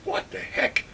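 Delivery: normal talking voice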